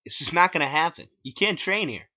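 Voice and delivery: high, loud put-on voice; Clyde's voice. A narrator's reading. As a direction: bark it out as the gatekeeper. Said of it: "he said".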